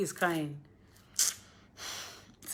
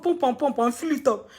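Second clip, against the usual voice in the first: ordinary talk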